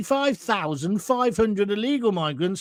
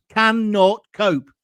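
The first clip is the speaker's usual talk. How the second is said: CANNOT COPE slow and punched; capitals read aloud; 4 dB louder, slower than usual. Acted. Host reading a viewer's capitalised message, emphatic and slow. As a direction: hammer out each word of CANNOT COPE. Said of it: "then in capitals".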